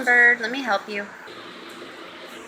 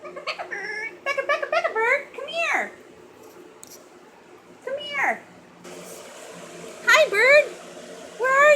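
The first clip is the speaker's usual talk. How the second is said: higher, soft singsong pet call; 7 semitones higher, 6 dB quieter than usual. Real, not acted. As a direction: lure the bird over sweetly. Calling pet bird by name.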